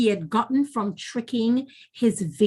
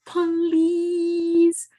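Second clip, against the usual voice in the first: higher, slow, pleading trickster; wheedling spider, begging in a high voice; 8 semitones higher, slower than usual. A narrator's reading. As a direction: plead with her sweetly for help. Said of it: "Anansi begged".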